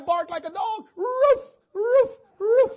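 high, loud shouted barks; crying barks. Retold or imitated. Preacher barking for God in front of the church, shouted. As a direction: bark out like you mean it. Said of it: "if God say".

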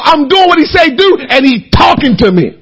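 ordinary talk

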